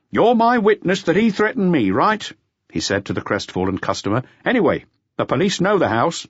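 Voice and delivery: rope the customer in; café owner. Acted. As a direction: owner asks the salesman to back him. Owner asks the customer for support.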